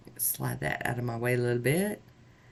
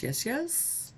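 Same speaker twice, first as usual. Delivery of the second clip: high pleased yes; 12 semitones higher, 6 dB quieter than usual. Real, not acted. Pleased with the nails.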